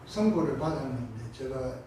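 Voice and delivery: ordinary delivery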